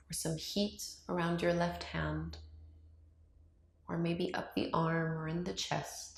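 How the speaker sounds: calm meditation voice with long, unhurried pauses; guided meditation. Acted. Yoga teacher guiding viewers to open up to love, calm meditation voice. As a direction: ease them through each breath, leaving silence between phrases.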